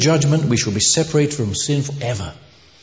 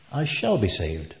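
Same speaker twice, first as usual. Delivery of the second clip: "shall" picked out, softer delivery; stress on SHALL; 3 semitones lower, 6 dB quieter than usual. Retold or imitated. Scholar explaining salvation's tenses to a lass, stressing "shall". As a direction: softly lean on "shall" to mark the future.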